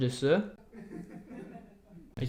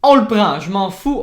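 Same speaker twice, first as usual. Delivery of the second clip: loud, higher, eager on le prend; excited grab; 4 semitones higher, 10 dB louder than usual. Real, not acted. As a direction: seize it with glee. Trouve le son Toy Train. (on le prend lancé fort et aigu)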